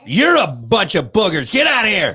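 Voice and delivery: loud teasing shoo; playful loud. Real, not acted. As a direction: shoo them out loudly. Teasing child out of room.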